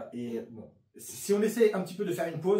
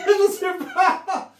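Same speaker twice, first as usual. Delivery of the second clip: laughing; 11 dB louder, much slower than usual. Real, not acted. Laughing at unsure mixing.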